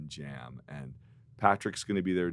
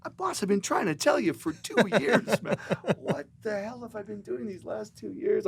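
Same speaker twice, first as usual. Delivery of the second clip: exasperated friend; 6 dB louder than usual. Retold or imitated. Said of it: "he goes".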